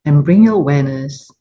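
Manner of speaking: calm, unhurried meditation guidance; guided meditation. Acted. Teacher inviting the group to ground awareness, calm guidance. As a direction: ease the group into stillness.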